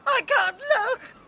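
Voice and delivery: high loud cry of horror; horrified cry. Acted. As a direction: shriek and turn away in disgust. Horrified at the kiss.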